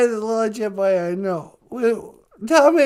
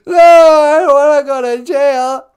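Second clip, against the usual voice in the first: mock sobbing; 10 dB louder than usual. Juicy panicking about jail.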